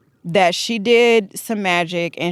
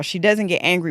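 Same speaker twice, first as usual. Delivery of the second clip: normal talking voice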